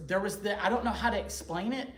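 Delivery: usual speaking voice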